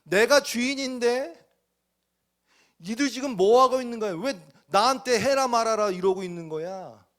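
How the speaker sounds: high, loud indignant master; Jesus as master. Acted. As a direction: demand like the rightful owner. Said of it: "무슨 얘기냐면".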